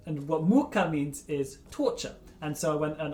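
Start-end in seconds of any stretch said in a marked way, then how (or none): 0.4-0.9 "muka" stressed on the first syllable, MU-ka. Shown as a demonstration. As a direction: lean on the U in "muka". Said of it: "with the stress on the U".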